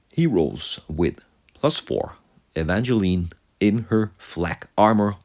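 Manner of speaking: normal talking voice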